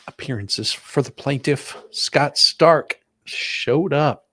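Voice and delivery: ordinary delivery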